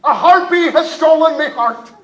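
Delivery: loud, high pirate declaration; stagey fake archaic declaiming. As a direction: proclaim it like a hammy pirate. Pirate captain declares in character.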